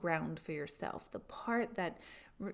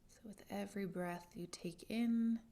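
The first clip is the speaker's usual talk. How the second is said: guided meditation; 8 dB quieter than usual. Acted.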